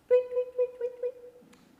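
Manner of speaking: high chirpy tweet tweet; squeaky birdsong imitation; bird tweets. Birds singing outside.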